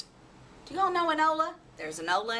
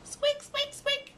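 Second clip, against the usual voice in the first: high squeaky mouse voice; mouse squeak; slower than usual. Acted. Tiny mouse answering the owlet with a high squeak. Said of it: "said the mouse".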